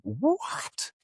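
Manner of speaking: high, rising "a homosexual?"; mock shock. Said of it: "she's just like".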